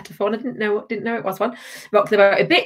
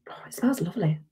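1 hushed sniff; 2 semitones lower, 9 dB quieter, faster than usual. Real, not acted.

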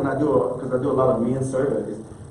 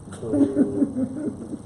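high laugh; 11 semitones higher, 4 dB quieter than usual. Real, not acted. Mocking silence after invite.

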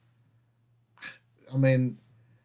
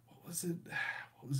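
whispered aside to himself; 2 semitones higher, 17 dB quieter, faster than usual. Real, not acted. Vlogger whispering to himself while recalling a quote. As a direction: drop to a breathy whisper while remembering.

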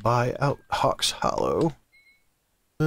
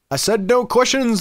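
higher, irritated rebuke; snapped; 8 semitones higher, 4 dB louder than usual. Acted. Hidden voice rebukes a questioning visitor, snapping. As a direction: cut them off with a raised voice. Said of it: "the voice snapped".

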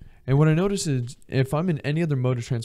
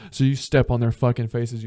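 ordinary talk

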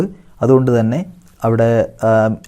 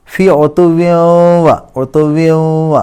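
slow, louder, higher chant; Quran recitation; 4 semitones higher, 5 dB louder, much slower than usual. Shown as a demonstration. Teacher chants and repeats the phrase. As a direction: sing the phrase out slowly. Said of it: "ഇദ്ഗാം ബിഗുന്ന".

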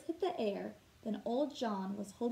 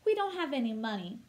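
fast and louder blurt; 5 dB louder, faster than usual. Acted. A shy little girl confesses to the shopkeeper, fast and louder. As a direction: let it tumble out all at once. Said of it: "the younger one blurted out".

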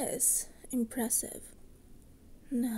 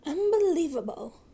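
louder, higher shocked indignation; indignant outburst; 4 semitones higher, 8 dB louder than usual. Acted. Sister outraged that their book was dumped like garbage, loud indignant cry. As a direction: spit it out in outrage.

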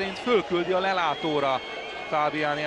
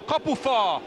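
excited commentary; 5 semitones higher than usual. Real, not acted. Hit the post, live.

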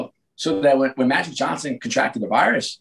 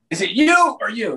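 mock accusation; 9 semitones higher than usual. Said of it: "pit people against each other".

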